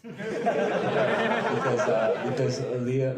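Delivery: high laugh breaking into the words. Real, not acted. Coach laughing while recalling Liliana's odd question, laughing. Laughing about the funny story.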